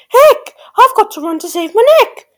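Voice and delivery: high, loud squeal of alarm; panicked shout. A narrator's reading. Cindy fleeing the prince at midnight, shouting high. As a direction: shriek it as Cindy in a hurry. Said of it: "She shouted".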